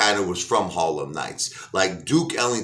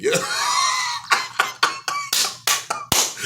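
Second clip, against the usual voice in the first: high shrieking mock laugh; hysterical laugh. Mafia gangsters laughing at Pryor, shrieking mock laughter. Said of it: "they start hysterical laughing".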